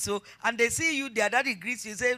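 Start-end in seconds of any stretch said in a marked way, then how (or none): none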